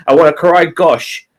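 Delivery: normal talking voice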